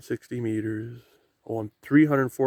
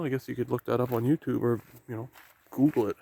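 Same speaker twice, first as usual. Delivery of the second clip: usual speaking voice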